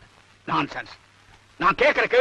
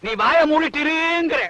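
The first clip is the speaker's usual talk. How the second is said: higher-pitched, drawn-out order to shut up; slow, raised-pitch telling-off; angry silencing; 6 semitones higher, slower than usual. Acted. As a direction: stretch the words out in exasperation. Husband silences his wife angrily.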